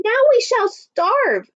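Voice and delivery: high, slow, cross wail of a mother; angry exclamation. Acted. Mother scolding Jack over the beans, angry exclamation. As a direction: scold him with a big pitch leap, drawn out. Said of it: "she exclaimed".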